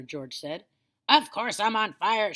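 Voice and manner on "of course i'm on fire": louder, higher angry yell; grandma yelling in outrage. A narrator's reading. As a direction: yell it back at him. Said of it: "she yelled".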